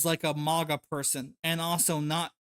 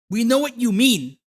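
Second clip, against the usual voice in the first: angry scold; 4 semitones higher, 6 dB louder than usual. Real, not acted. Scolding the video creator.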